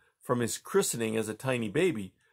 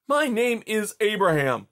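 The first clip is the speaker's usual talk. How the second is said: higher, louder "My name is Abraham"; angry insistence; 9 semitones higher, 4 dB louder than usual. A narrator's reading. Boy repeating his name at the Tsar, angrier. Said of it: "with rage".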